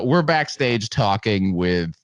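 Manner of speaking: ordinary talk, nothing marked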